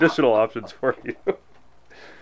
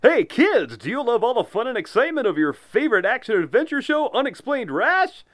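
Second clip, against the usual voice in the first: ad announcer; 7 semitones higher than usual. Acted. Kids cereal ad read.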